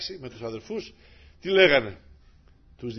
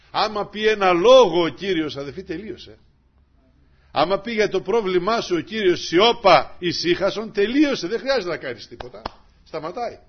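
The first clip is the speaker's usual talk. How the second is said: full-force preaching; 7 semitones higher, 10 dB louder than usual. Acted. (κήρυγμα με πάθος)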